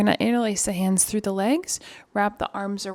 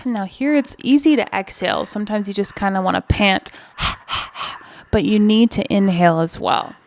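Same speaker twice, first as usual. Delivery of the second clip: coaching over effort; 7 dB louder than usual. Real, not acted. Pushing class through hard squat.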